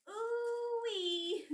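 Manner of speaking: high, loud squealed ooh-wee; excited squeal. Real, not acted. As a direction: squeal it out with glee.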